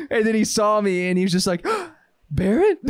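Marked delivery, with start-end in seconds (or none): 2.3-2.8 higher mock squeak of recognition; high-pitched surprise. Old classmate spots friend at gym, high-pitched imitation. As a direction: imitate a big guy's voice jumping up. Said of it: "his voice got three pitches higher".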